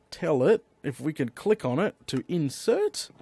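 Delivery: normal talking voice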